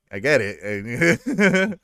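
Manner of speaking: very slow, drawn-out sigh of a reply; dragged-out weary. Retold or imitated. Loki slapped by Jane, very slow weary reply. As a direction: stretch it out, fed up.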